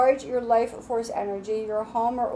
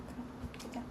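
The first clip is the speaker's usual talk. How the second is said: unvoiced whisper, hushed and quick; whispered command; 17 dB quieter, faster than usual. Real, not acted. Reader whispering to her pet to get down off the table, hushed. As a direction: shoo the pet down without making noise.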